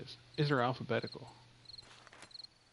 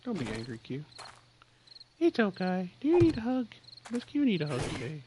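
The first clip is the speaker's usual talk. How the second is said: cooing to a pet; 5 semitones higher, 4 dB louder than usual. Real, not acted. Cooing at Q to ask if it needs a hug. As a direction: comfort a little creature.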